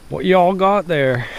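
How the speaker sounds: high, slow Texas drawl imitating Sean; Texas accent. Imitating Sean asking the neighbors in Texas accent. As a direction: put on a Southern Texas twang, slow. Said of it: "in her Texas accent".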